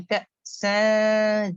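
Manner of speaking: سن held very long and nasal in a light position; light ikhfa demo. Shown as a demonstration. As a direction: draw out سن slowly with the tongue kept light. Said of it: "light letter کی position رکھیں". (سن کو لمبا، ہلکی ناک کی آواز میں کھینچیں)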